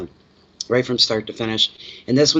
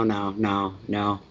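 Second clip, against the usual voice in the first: normal talking voice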